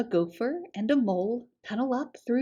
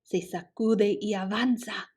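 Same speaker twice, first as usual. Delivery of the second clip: ordinary delivery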